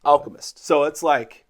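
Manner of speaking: usual speaking voice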